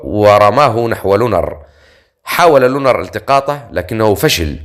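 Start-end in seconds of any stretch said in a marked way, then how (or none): none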